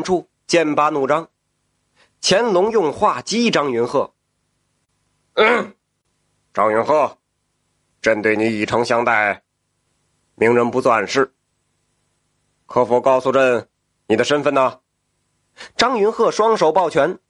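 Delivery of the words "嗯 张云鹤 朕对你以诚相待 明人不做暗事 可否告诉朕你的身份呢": lower, measured imperial tone; emperor voice. Acted. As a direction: speak as a curious emperor. The emperor coaxes the prisoner.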